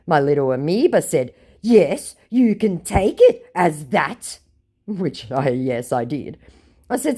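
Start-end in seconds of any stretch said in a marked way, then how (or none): 1.6-4.4 mock scammer voice.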